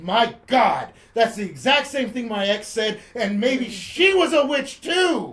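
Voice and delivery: loud revelation climbing high; comic outraged realization. Acted. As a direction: build to a mock-horrified discovery. Comic realization about his ex.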